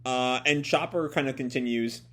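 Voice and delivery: ordinary talk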